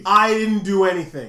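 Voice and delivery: talking over the accuser to deny bullying, insistent protest. Real, not acted. Push the denial out loudly.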